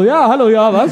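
fake phone call. Co-host teasing him over upside-down phone, fake phone call. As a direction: play loudly at being on the phone. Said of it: "die ganze Zeit das Handy falsch rum".

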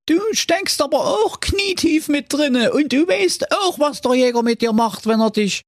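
very high, indignant wolf. A narrator's reading. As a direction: squeal back, offended. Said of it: "Das ließ der böse Wolf nicht auf sich sitzen".

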